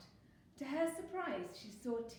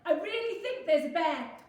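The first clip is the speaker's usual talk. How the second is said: high, loud insistence; urgent warning; 8 semitones higher, 12 dB louder than usual. A narrator's reading. Fox warning roaring friends, loud and urgent. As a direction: insist over the noise.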